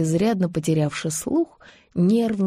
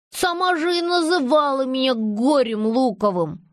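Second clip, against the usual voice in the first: grumbling; 5 semitones higher than usual. Acted. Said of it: "проворчал мальчик".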